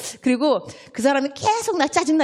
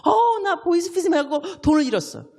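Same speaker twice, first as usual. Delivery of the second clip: high-pitched voice of a woman who lost money; upset church member; 8 semitones higher than usual. Retold or imitated. Church member telling the group she was scammed, high and plaintive. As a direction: whine it out like a scam victim telling friends.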